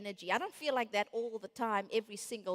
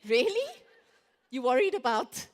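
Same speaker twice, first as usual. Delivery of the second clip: mock disbelief; 7 dB louder than usual. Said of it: "other people will say".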